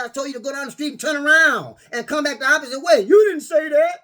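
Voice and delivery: high, loud exasperated yell; retold shouting. Arguing with the coworker, loud exasperated yell. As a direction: shout him down in disbelief.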